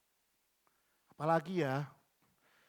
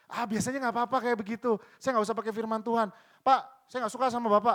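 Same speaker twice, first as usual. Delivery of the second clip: higher, louder blunt complaint; mock bold rebuke; 6 semitones higher, 8 dB louder than usual. Employee bluntly telling the boss off, high and loud. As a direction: blurt it straight at the boss.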